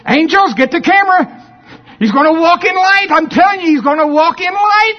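high, loud excited announcement; thrilled, calling for the camera; excited God. Retold or imitated. God tells angels to grab the camera, high excited voice. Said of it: "And God's going".

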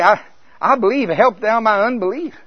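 high, loud acted cry for help; impassioned plea. Father honestly begging Jesus for help, impassioned.

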